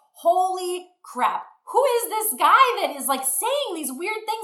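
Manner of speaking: holy crap dragged out high; shocked disbelief. Retold or imitated. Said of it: "And now I'm like".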